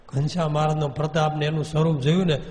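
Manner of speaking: plain reading voice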